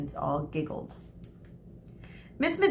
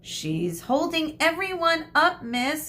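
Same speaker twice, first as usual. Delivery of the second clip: high, whiny complaint; complaining; 9 semitones higher than usual. A narrator's reading. Girl complaining to the teacher about the late classmate. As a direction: whine about the hold-up. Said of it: "Cassandra complained".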